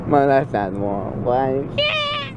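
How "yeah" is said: squealed high yeah. Real, not acted. Loopy high exclamation.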